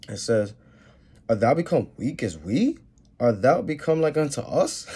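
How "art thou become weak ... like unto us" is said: taunting, rising questions; mocking the fallen king; mocking scripture read.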